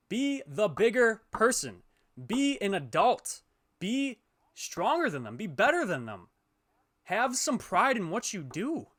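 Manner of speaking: slow, loud, emphatic commands; loud lecturing. Real, not acted. Streamer lecturing a cheater, slow and loud. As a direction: hammer each order home slowly. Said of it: "I'm sorry for being loud".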